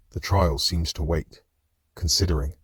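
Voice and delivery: ordinary delivery, nothing marked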